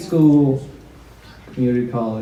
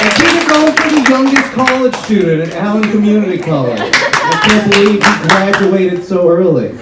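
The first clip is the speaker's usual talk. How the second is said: loud, higher hype call to the crowd; crowd-pumping shout; 8 semitones higher, 6 dB louder than usual. Acted. Host keeping the applause going, loud hype call. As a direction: keep the energy up, shout it out. Host hyping after intro.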